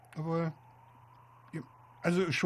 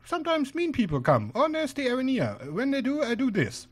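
higher-voiced spider guard; 6 semitones higher than usual. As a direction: explain your guard duty in a raised voice. Plays guard spider in game.

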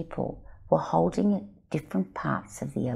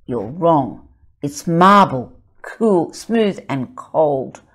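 louder, slow, firm rebuttal; contradicting child; 9 dB louder, slower than usual. Acted. Child contradicting another, louder and slow at the end. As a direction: shut them down. Children arguing about the secret.

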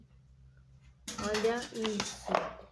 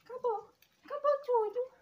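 high cooing announcement; baby talk to dog; 14 semitones higher than usual. Acted. Owner telling her dog the banana is gone, high baby talk. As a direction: tell the puppy it's all gone.

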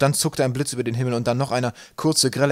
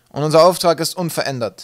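loud, raised captain's address; commanding announcement; 6 semitones higher, 10 dB louder than usual. A narrator's reading. Captain continuing his briefing to the crew, loud and commanding. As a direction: announce it to the whole deck.

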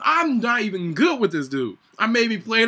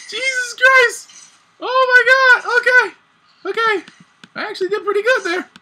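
stunned relief; 14 semitones higher, 4 dB louder than usual. Real, not acted. Relief after the fight.

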